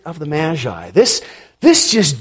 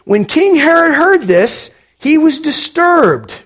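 higher pitch, laughing through it; amused reading; 8 semitones higher than usual. Acted. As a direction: read it with a knowing chuckle.

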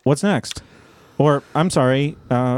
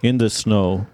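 put-on Russian accent; 5 semitones lower than usual. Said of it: "You sound more like you're from Bulgaria".

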